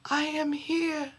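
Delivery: barely reach him from the bottom of the pit; faint reply. A narrator's reading. Injured younger brother answering faintly from the pit, weak soft voice.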